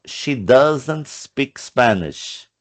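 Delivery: slow teacher reading; slow model sentence. Shown as a demonstration. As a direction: read out very slowly, word by word. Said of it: "Ó".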